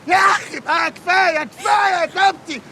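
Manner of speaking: exasperated shout. Acted. Atef yells for it to stop.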